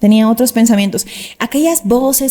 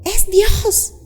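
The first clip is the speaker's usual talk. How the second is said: retold exclamation; 9 semitones higher than usual. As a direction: imitate the friend crying out, lightly. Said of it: "ella gritó y dijo".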